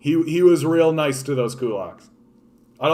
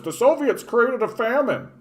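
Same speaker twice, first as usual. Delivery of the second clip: high-pitched mock quote; mocking common claim; 10 semitones higher than usual. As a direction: parrot the claim in a much higher voice. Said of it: "that everybody says".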